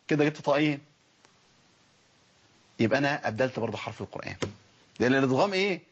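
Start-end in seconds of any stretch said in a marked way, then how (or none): none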